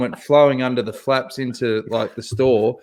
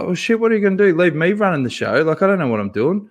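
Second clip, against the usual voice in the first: higher, panicked retort to the boss; 6 semitones higher, faster than usual. Retold or imitated. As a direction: throw it back in disbelief, voice up high. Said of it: "And I'm like".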